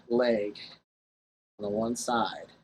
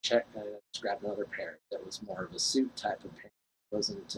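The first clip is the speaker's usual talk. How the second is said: normal talking voice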